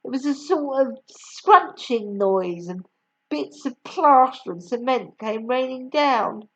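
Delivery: ordinary delivery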